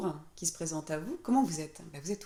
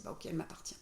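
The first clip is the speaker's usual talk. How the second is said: muttered; 9 dB quieter, faster than usual. Retold or imitated. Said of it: "on se dit".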